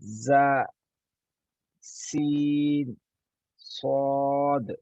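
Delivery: ज़ा buzzed, सीन a long thin hiss, सौद long and full-mouthed; three letters demo. Shown as a demonstration. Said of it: "दोबारा से अदायगी करता हूँ". (तीनों हरूफ़ का फ़र्क़ साफ़ दिखाते हुए)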